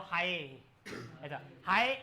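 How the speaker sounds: हाय with its last vowel drawn out long; stretched hi. Shown as a demonstration. Teacher showing the class reverberation, a drawn-out hi. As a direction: let the हाय ring on and linger. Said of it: "वो elongate हो जाएगा".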